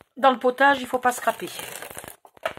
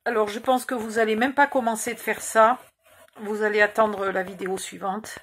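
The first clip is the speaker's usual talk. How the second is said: normal talking voice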